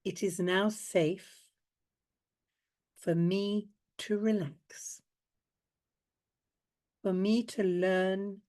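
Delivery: slow, soft spoken affirmation. Shown as a demonstration. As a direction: say it gently like a vow. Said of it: "say quietly after me".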